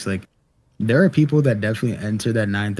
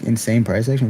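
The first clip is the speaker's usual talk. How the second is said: ordinary talk, nothing marked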